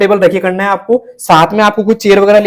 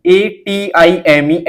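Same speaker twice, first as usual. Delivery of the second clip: very slow, each letter spelled out separately; slow classroom dictation of single letters; spelling letter by letter; much slower than usual. Shown as a demonstration. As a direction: dictate each letter slowly with a pause after it. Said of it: "ऐसे पढ़ना है दोस्तों".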